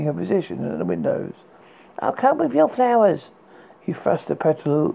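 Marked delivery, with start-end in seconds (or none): 2.0-3.3 high called-out line to a window; prince calling up. Acted. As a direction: call up brightly to the tower. Prince calls up to tower.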